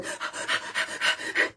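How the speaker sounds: sharp fearful gasp; startled gasp. Pretend. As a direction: gasp in fright. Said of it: "We go".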